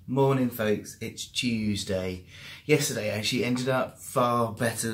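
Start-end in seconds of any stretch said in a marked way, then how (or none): none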